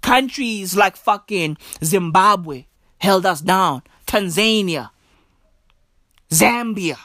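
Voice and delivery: ordinary talk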